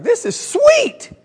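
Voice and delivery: high, stretched-out delight; drawn-out, high-pitched rave; thrilled exclamation. Retold or imitated. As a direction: crow over the new car. Retells delight at driving new car.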